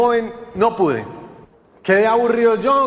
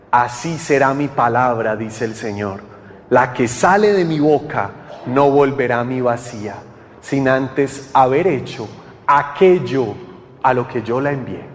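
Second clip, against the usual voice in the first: very low, measured delivery; low-pitched, reverent repeat of scripture; solemn scripture recitation; 7 semitones lower than usual. Acted. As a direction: let the verse sink in, deep and deliberate.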